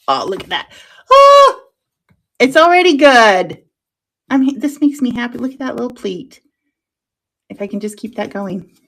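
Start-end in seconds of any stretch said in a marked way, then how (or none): 1.1-5.8 high, loud burst of delight. Real, not acted. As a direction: squeal with joy at the result. Delighted at her pleat.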